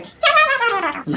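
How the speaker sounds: slow, very high trill; high trilling coo. Retold or imitated. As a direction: trill like a doting auntie. Auntie trilling.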